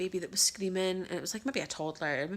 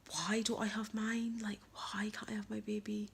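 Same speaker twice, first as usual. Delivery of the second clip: higher, slow, whispered through tears; grieving whisper; 5 semitones higher than usual. Real, not acted. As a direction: let the question break out barely voiced. Grieving her lost son.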